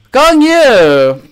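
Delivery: high, loud burst of the name; star-struck cry; excited shout. Real, not acted. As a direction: call the name out in delight.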